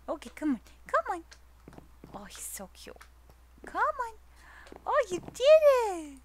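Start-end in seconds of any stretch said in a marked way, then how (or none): 0.9-1.2 high coaxing call to a pet. Real, not acted. Calling the pet. 2.1-3.0 soft whispered aside; hushed whisper, much softer; whispered fond aside about the pet. Real, not acted. Cooing over pet. 3.7-6.2 high, louder praise for a pet. Real, not acted. Pet succeeds.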